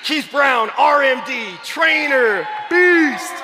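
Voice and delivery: loud, high ring-announcer style roll call; shouted, separated accolades; excited hype. Real, not acted. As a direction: punch out each title with pride. Announcing another star trainer.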